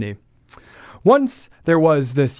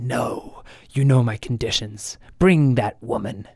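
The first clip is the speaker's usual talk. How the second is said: cold witch; 3 semitones lower than usual. Acted. Witch refuses demon's plea.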